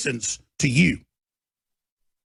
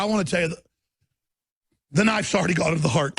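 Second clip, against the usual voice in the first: ordinary talk, nothing marked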